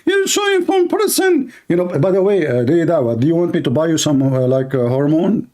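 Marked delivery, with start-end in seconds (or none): 0.0-1.5 high, put-on falsetto woman mimicking the clip; mock female voice. Mimicking the preacher in a high, mocking woman's voice. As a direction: squeak it out like a mocking lady. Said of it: "make his voice to speak like a female".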